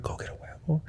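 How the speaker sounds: imitating a hushed, whispered order; mock whisper, breathy and urgent; retold whisper. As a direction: mimic the wife whispering "go get a huevo". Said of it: "my girl's like".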